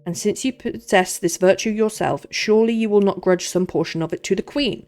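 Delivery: loud, grand posh musketeer, lips pushed forward; posh Porthos. Acted. Porthos lecturing Aramis about the queen, loud and posh. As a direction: lecture him grandly from the front of the mouth. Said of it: "I'm putting Porthos in the front of the mouth".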